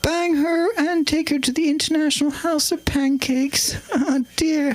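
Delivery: high regal voice; high-pitched royal impression; the Queen. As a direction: play it as a high-voiced, proper monarch. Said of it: "Thanks to the Queen".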